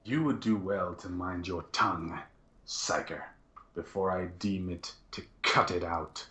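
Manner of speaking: low, soft threat to a servant; stern, hushed inquisitorial warning; menacing Inquisitor. Acted. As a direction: warn the psyker quietly, with measured menace. Game master voices threatening Inquisitor.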